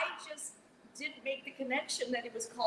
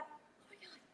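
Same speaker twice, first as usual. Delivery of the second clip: panicked whisper; 14 semitones higher than usual. Retold or imitated. Panicked thought, retold.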